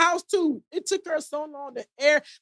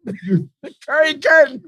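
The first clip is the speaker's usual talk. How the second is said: slow, louder announcement of the name; drawn-out, loud title call; announcing; 7 dB louder, slower than usual. Real, not acted. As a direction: present it like a segment title. Announcing a new segment.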